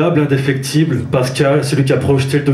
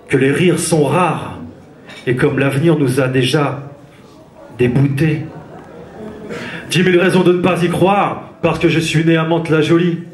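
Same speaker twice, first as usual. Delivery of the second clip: rhythmic spoken-word slam delivery; slam poetry; 6 semitones higher than usual. Acted. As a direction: perform it like a slam poet on stage. Slam poem performed on stage.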